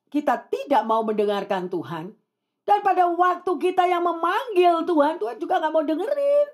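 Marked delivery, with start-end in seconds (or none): 2.6-6.5 high-pitched, insistent warning; forceful preaching. Real, not acted. Preacher warns those who ignore God's call, forceful preaching. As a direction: drive the warning home from the pulpit.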